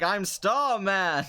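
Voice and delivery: slow, lightly grand superhero announcement; hero line. Retold or imitated. Bowie in their comic transforming, mock heroic and slow. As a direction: proclaim it like a cheesy comic hero. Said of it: "he'd be like".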